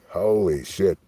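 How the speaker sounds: ordinary talk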